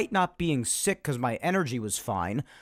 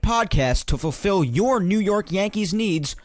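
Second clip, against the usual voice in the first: loud podcast open; 3 semitones higher, 5 dB louder than usual. Acted. Host opening his podcast, loud and hyped. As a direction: sell the show like a radio host. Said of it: "my loud open".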